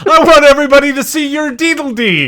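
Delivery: high, loud shout imitating a little boy; mock child's yell. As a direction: holler it like a kid announcing to everyone. Reenacts the boy's yell with substitute word.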